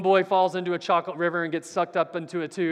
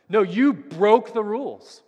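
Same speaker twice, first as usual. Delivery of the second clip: slow, louder retold angry shout; Mister Wonka screaming; 3 semitones higher, 6 dB louder than usual. Candy maker scolding the boy and grandfather, retold angry yell. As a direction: bark the rule-breaking verdict, slowed and raised. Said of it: "he's screaming at them".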